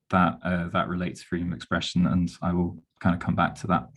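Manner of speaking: ordinary talk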